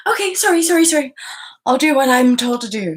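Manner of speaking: high, loud, eager-to-please sorry; mock meek apology. Mimicking the victim apologising, high loud mock meekness. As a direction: squeak out a cowering apology.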